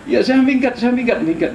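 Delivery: high, repeated mock pleading; acted frantic surrender. Spirit promises the preacher to leave, mock frantic surrender. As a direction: give in hastily as the imitated captive. Acts beaten spirit giving in.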